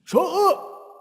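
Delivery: high sharp retold shut up; angry shout. How the dad's snap felt, a high sharp shout. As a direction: bark it out, mock fury. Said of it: "that's what it felt like I was saying".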